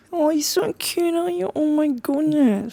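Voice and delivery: very high, drawn-out cooing; puppy voice. Shown as a demonstration. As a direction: coo slowly over a cute puppy. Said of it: "the puppy voice".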